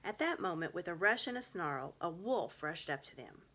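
ordinary delivery

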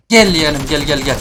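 loud taunting call to come over. Real, not acted. Player beckons the approaching enemy, loud taunting call. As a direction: call it out loudly at an opponent.

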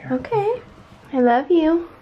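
high tender goodnight. Real, not acted. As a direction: coo a loving goodnight. Mom says goodnight to toddler.

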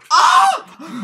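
sharp high-pitched yelp; mock fright scream. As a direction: let out a loud, high scream to startle. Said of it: "We scared you".

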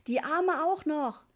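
high, loud, stretched-out yell; a bossy child yelling orders; shouted demand. A narrator's reading. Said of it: "schrie Richie". (laut und gedehnt geschriener Befehl)

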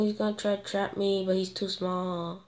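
ordinary talk

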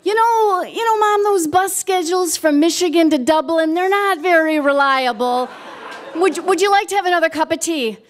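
high, placating retold excuse; diverting. Daughter distracts her mother from the bus schedule, high and placating. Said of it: "I would try to divert her".

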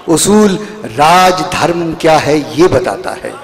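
declaimed verse, each line building; stately nazm recitation. Acted. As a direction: recite the poem with stately force. Poet recites nazm titled Usool.

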